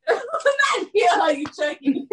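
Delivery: shrieking through laughter; loud high laughing protest; laughing shout. Real, not acted. Reacting to a friend's joke by shrieking with laughter. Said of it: "laughing shout".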